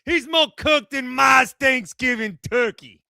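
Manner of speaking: put-on New York accent. Joins mock New York caller bit.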